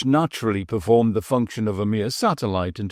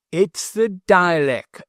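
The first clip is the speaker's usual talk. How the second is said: slow, lordly mock squire; a hint of the squire's grand tone, slowly; imitating the squire; 7 semitones higher, 3 dB louder, slower than usual. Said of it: "says he".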